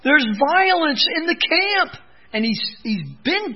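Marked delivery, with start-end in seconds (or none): 0.0-2.0 crying out. Retold or imitated. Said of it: "he's crying out".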